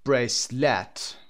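بریسلت slow, with a full stressed "LET" ending; "brace-LET" for بریسلت, weight on the last syllable; wrongly stressed schwa. Shown as a demonstration. Teacher showing what is never said, stressed final syllable. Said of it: "هیچ وقت نمیگیم".

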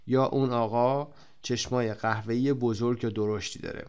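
ordinary delivery

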